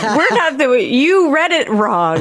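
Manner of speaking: high, drawn-out protest; mock exasperation. Cast answering the misreading host, mock exasperation. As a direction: stretch it out in high, exasperated disbelief. Retelling the cast's reaction.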